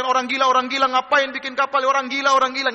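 high, loud repeated taunts; imitated jeering. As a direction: chant the insult like a heckling crowd. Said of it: "mereka ngejek-ngejek Nabi Nuh".

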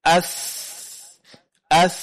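drawn-out "as", air flowing; tha with sukun. Shown as a demonstration. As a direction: hold the hissing "as" so it flows out. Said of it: "Seperti contohnya huruf tha".

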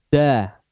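each syllable a single loud drawn-out call; drilled letter sounds. Shown as a demonstration. Teacher leading a recitation drill, loud calls.

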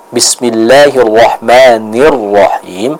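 Bismillahirrahmanirrahim slow, nun with a crisp i vowel; clear kasrah on nun. Shown as a demonstration. Quran teacher correcting a student, slow demo recitation. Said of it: "Yang pertama, untuk huruf nun".

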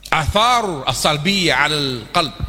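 slow, deliberate Arabic recitation; Arabic term. Acted. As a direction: set the term apart slowly. Arabic term read slowly.

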